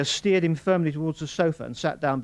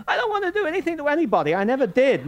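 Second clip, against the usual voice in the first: higher, louder, fast whining protest; groaning protest; 10 dB louder, faster than usual. Acted. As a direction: protest in anguish. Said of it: "Morris groaned".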